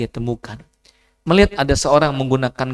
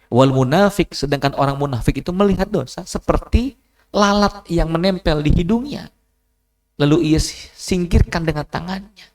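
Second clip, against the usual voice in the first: fiery preaching; 7 semitones higher, 4 dB louder than usual. Acted. Preaching at full force.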